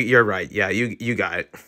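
normal talking voice

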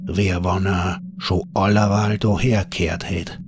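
plain reading voice

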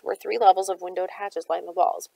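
ordinary delivery

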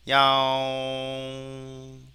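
loud, long-held meow on a level pitch; cat meow imitation. Shown as a demonstration. Teacher recording cat sounds for training, imitated meowing. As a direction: stretch out each meow like a cat. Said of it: "고양이 사운드를 한번 우리가 저장해 보면".

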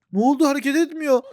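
normal talking voice

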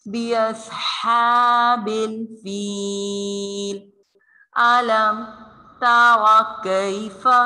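slow melodic tajweed chant; Quran recitation. Acted. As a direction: recite it slowly and drawn out. Recites the verse.

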